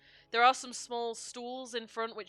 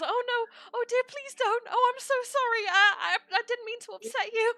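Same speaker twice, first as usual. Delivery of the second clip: high, loud, flustered apology; panicking; 14 semitones higher, 7 dB louder than usual. Acted. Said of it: "begins immediately panicking".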